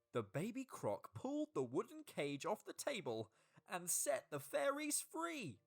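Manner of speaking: usual speaking voice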